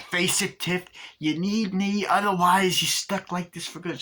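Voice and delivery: imitated bickering; taunting doll. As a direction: press the point, lording it over her. Imitates Chucky bickering with Tiffany.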